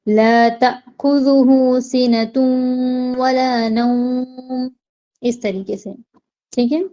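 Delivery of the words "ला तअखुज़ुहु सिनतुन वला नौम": तअखुज़ुहु with a sharp catch on the hamza; slow recitation with a jolted hamza in तअखुज़ुहु; jerked hamza. Shown as a demonstration. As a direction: cut the hamza in तअखुज़ुहु with a quick jolt. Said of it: "इसको झटके से पढ़ना है".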